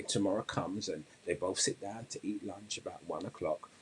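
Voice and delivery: ordinary delivery, nothing marked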